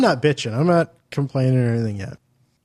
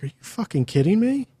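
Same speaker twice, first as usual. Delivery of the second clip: disbelief; 7 semitones higher than usual. Retold or imitated. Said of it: "I'm like".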